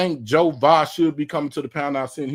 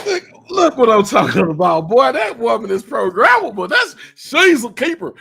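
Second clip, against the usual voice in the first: loud hype; 10 semitones higher, 7 dB louder than usual. Real, not acted. Host hypes guest's redo.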